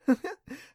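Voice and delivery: a high bright laugh; light girlish laugh. A narrator's reading. Clancy to Adam, laughing off his warning. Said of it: "She laughed".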